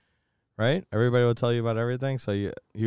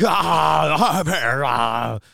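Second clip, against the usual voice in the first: mock annoying shouts; 5 semitones higher, 5 dB louder, much slower than usual. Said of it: "Hiram was like".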